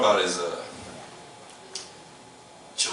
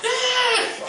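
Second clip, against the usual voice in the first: high drawn-out mock tantrum wail; mock child's tantrum cry; kid tantrum wail; 5 dB louder than usual.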